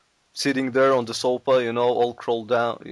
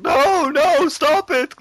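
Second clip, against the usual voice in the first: high, loud hammed-up plea; mock pleading; 12 semitones higher, 4 dB louder, much slower than usual. Boris pleading with the vodka, high and loud. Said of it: "they're like".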